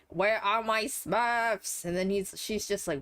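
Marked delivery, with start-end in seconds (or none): 0.1-1.8 drawn-out, higher-pitched Gargamel imitation; hammed-up, slow demand; mock villain demand. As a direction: stretch every word like a cartoon villain. Acting out Gargamel demanding his Smurfs.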